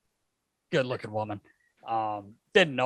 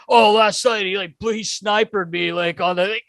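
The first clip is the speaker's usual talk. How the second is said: higher, louder, worked-up retelling of a teammate; acting out the young guy tattling, riled up; mock excited complaint; 7 semitones higher, 7 dB louder than usual.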